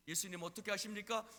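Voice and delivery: ordinary talk, nothing marked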